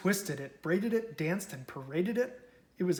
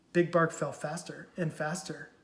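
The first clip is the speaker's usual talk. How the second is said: plain reading voice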